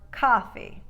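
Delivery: "coffee" with ah as in spa; "coffee" with a wide-open first vowel. Shown as a demonstration. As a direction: say "coffee" as cah-fee. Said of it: "You'll never hear".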